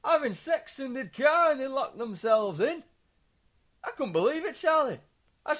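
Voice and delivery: lightly voiced stunned father; mock dad voice. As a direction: drag it out like a shocked dad. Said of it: "he just kept saying".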